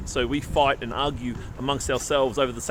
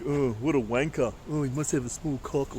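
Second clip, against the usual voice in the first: mock sneer; 5 dB quieter than usual. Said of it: "we might think".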